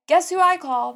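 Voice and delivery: loud, high rhetorical question; a big loud high tease; loud exclamation. Real, not acted. Dreaded confession to the viewers.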